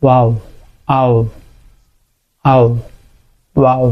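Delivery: ওয়াও with lips rounded like a petal; rounded lips demo. Shown as a demonstration. Teacher showing the waw sound, lips rounded like a petal. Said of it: "গোলাপ ফুলের পাপড়ির মতো".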